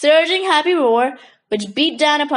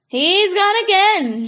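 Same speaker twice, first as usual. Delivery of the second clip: spluttering laughter; 7 semitones higher, slower than usual. A narrator's reading. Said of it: "Joey spluttered".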